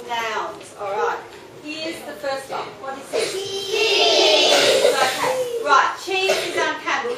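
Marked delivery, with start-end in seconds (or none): 3.3-4.9 the whole class shouts the answer; chorus answer. Real, not acted.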